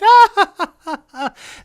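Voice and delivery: loud high jeering laugh; mocking laugh. Radio host ending his heckler act, loud jeering laugh. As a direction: cackle at the joke you just made. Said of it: "point at them and go".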